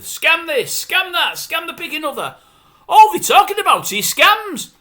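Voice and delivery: high-pitched, fed-up list, rising to loud; exasperated rant. Real, not acted. Viewer fed up with scam items on morning TV, exasperated. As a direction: reel them off in mounting disbelief. Ranting about repetitive TV show.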